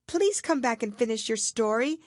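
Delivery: put-on girl calling after the mouse. A narrator's reading. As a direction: call out after it. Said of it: "Alice called after it".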